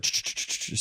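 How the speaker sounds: whispered ch-ch-ch clicking sound; rapid tongue clicks like tapping through phone alarms; soft unvoiced clicking; clicking noise.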